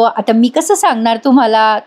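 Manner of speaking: ordinary delivery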